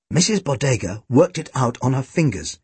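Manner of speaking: ordinary delivery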